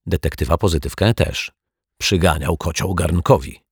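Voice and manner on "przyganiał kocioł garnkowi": usual speaking voice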